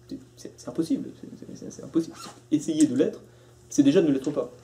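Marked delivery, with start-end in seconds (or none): none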